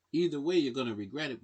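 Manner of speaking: usual speaking voice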